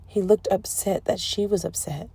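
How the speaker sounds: ordinary delivery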